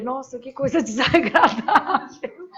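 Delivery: slow, drawn-out mock-polite complaint with a laugh; polite restrained exclamation. Teacher recalls holding back before the class, mock-polite and drawn out. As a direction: stretch out a prim complaint, laughing. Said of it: "Tem que falar educadinha".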